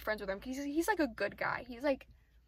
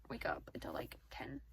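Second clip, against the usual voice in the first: whispered aside; 8 dB quieter than usual. Real, not acted.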